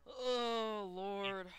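loud, drawn-out lament, sliding down; exasperated wail. Real, not acted. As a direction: wail it out at the bad result. Groans at race outcome.

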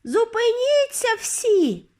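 very high, drawn-out witch shriek; piercing, sustained high call; piercing cry. A narrator's reading. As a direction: cut through the hall like a bugle. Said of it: "Її пронизливий голос прорізав залу".

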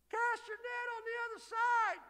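high far-carrying shout from shore; stranger calling out. Retold or imitated. Preacher voicing the man on shore calling to the fishermen, high shout. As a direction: call it out across the water, high. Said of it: "He's just a guy".

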